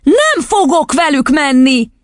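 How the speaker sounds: put-on high, drawn-out child's refusal; emphatic childish outcry; defiant cry. A narrator's reading. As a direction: refuse like an upset little girl. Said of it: "kiáltott fel hirtelen".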